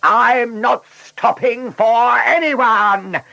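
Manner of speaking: high, loud, drawn-out roar. A narrator's reading. Blunderbuss shooting through the traffic lights, roaring. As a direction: roar it out as a reckless car. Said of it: "he roared".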